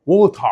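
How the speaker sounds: exaggerated British "ووتر"; British strong T. Shown as a demonstration. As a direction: hit the T hard in "ووتر" and drop the R. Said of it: "هيقول التي بقوه ويوقع الار".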